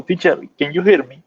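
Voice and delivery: ordinary delivery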